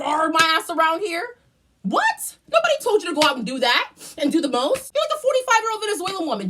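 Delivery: high, loud, quick sassy rant. Retold or imitated. Ranting at the assistant manager, loud and sassy. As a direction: rant at her like you're fed up.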